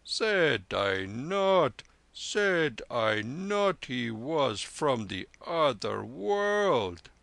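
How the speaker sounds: put-on slow, drawn-out triumphant old monk; a proud holy man crowing over a miracle; proud lama. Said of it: "cried the lama proudly".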